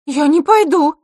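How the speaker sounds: high, loud indignant refusal. A narrator's reading. Girl refusing to be taken along, indignant. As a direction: refuse hotly, voice shooting up. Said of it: "возмутилась девочка".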